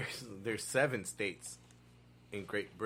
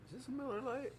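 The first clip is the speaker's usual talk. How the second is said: teasing jab; 14 semitones higher, 5 dB quieter than usual. Real, not acted.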